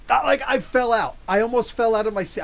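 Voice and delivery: excited. Real, not acted. Recalling the Mister Mind surprise.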